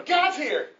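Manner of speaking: put-on rally the others like a thrilled child; celebrating children. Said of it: "there's a celebration".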